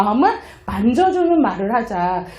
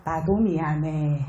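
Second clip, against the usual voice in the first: soft reply; 10 semitones lower, 8 dB quieter, slower than usual. Retold or imitated. Friend's reply text.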